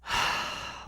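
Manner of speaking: long, soft exhaled sigh; acting out a not-wanting-to-get-up sigh; imitated weary sigh. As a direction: let out a mock sigh of reluctance. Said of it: "I'm making those same sounds".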